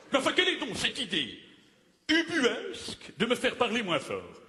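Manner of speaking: high, outraged exclamation; theatrical indignation. Acted. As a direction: puff up with offended grandeur. Offended at being asked to speak softer.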